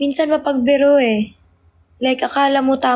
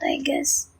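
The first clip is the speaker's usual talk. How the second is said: coughing, voice gone to breath; chesty cough; 8 dB quieter than usual. Real, not acted. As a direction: croak it out between coughs. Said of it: "Uy, ubo ng ubo".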